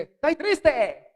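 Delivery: alto, lento y animado, con la voz de otro; alegre aunque triste. Retold or imitated. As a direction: dice que está triste sonando contento. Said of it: "como tiene personalidad alegre". (persona alegre que dice "estoy triste", aguda y lenta)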